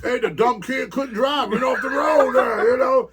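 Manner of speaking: the prankster brushing off the crash, loud and high; mock Fuji protest. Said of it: "he probably thought that was great".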